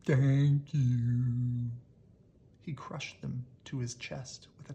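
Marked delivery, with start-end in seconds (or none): 0.0-1.7 loud, booming giant's thanks; deep booming voice. Acted. Monster speaks his first words, deep and booming. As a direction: rumble it out like a gentle giant. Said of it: "in a deep, booming voice".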